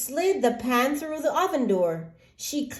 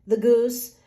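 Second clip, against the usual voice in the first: plain reading voice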